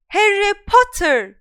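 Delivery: high, loud, slow shout of his name; outraged shout. Acted. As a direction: bellow at the pupil. Professor catching Harry.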